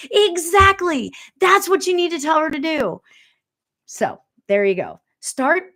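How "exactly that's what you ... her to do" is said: eager agreement. Retold or imitated. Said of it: "He's like".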